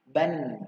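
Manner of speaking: "Ban" answered loud and clear; tanwin ban. Shown as a demonstration. Teacher answers ban for the class. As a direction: call out ban. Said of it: "Dibacanya apa?".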